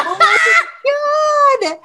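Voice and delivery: high, slow laughing cry; laughing shout. Real, not acted. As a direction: shriek it mid-laugh.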